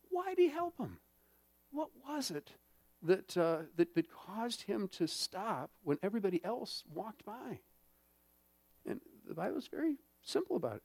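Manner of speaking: usual speaking voice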